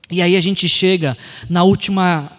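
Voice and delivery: ordinary talk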